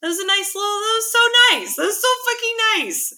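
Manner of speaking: high, loud, gushing praise; gushing excitement. Real, not acted. Podcaster gushing about a friend's kind gesture, excited. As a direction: pitch it way up and gush.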